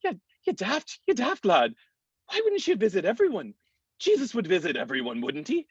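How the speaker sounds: high, lilting mock Scottish accent; bad Scottish accent, voice jumping high. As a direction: imitate a scolding Scottish parishioner, pitched well up. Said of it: "without me doing a bad Scottish accent".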